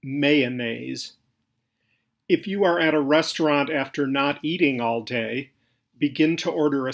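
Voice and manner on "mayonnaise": mayonnaise in three syllables, "MAY-uh-nayz"; proper pronunciation. Shown as a demonstration. Narrator giving the correct form, mayonnaise pronounced "MAY-uh-nayz". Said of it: "Proper pronunciation is".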